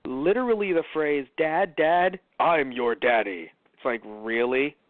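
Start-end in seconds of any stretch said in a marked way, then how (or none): none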